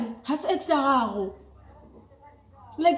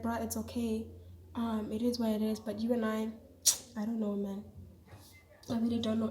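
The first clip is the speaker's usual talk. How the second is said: quiet resigned; 3 semitones lower, 10 dB quieter than usual. Retold or imitated. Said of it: "I'm just like".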